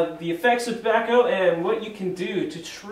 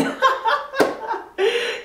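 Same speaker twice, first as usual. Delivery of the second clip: high laugh. Real, not acted. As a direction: burst of high-pitched laughter. Laughing at the prank.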